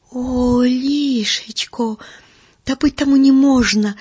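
shocked outcry. Acted. Uncle Andrey protests the stolen goat, shocked outcry. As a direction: throw up the voice in mock horror.

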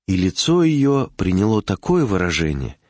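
usual speaking voice

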